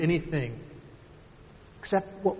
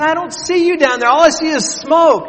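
put-on scared little girl; 13 dB louder than usual. Trapped daughter calling down to her father, frightened. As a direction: plead like a terrified little girl. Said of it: "She said".

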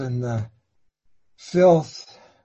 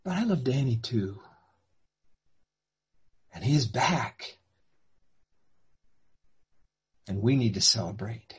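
soft gentle plea; 4 semitones lower, 9 dB quieter than usual. Acted. Father gently reasoning with son.

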